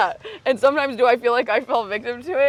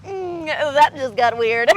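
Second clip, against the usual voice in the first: slow, higher, put-on voice; imitating Alec's bit; 5 semitones higher, 4 dB louder, much slower than usual. Teasing her friend's mushroom-trip bit, slow put-on voice. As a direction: drag it out like a deadpan meme joke. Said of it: "be like".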